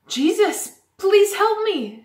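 high, loud storybook cry for help; acted shout from a beggar; pleading shout. A narrator's reading. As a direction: call out slowly, begging for help. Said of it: "shouted Bartimaeus".

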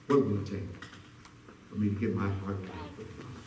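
low, soft, pause-filled appeal; solemn altar call. Real, not acted. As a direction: gently invite them to respond.

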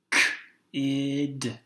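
K-id sounded out in two parts; blended sounds. Shown as a demonstration. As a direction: split K-id into onset and rime. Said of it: "One more time".

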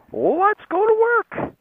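slow, high sing-song; fake-eager rallying call; mock enthusiasm. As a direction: draw it out in a high, hammed-up cheer. Mock enthusiasm for going to work.

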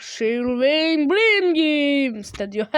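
very slow, drawn-out name; hammed-up, drawling studio name; mock announcement. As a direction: stretch every word of the title. Mockingly reads the studio name.

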